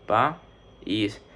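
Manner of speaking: "pa, is" as two separate beats; dividido em sílabas. Shown as a demonstration. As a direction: say "pa", pause, then "is". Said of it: "Se divide así".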